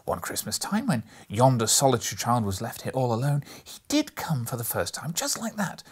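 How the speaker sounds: put-on high, loud, breathless childlike excitement; between laughing and crying. A narrator's reading. Scrooge gushing over storybook heroes, half laughing, half crying. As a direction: gush with tearful glee. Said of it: "in a most extraordinary voice, between laughing and crying".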